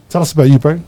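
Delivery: usual speaking voice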